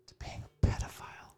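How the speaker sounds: whispered punchline; under-the-breath confession; hushed aside. Real, not acted. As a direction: drop to a whisper for the taboo bit. Joking taboo word spoken under breath.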